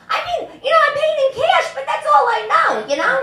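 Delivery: high, coy innocent voice; coy mob wife playing innocent, high and laughing. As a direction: play dumb about the cash.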